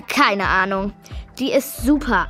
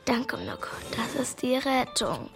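whispering; 10 dB quieter than usual. Acted. Hiding from burglars.

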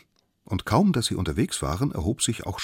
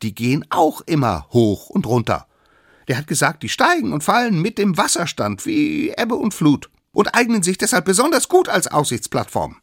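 put-on high, loud, insistent child figure; cocky little expert; 12 semitones higher, 8 dB louder than usual. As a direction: defend the theory at full volume. Youngest lectures with invented lore. (kleiner Besserwisser, hoch und laut dozierend)

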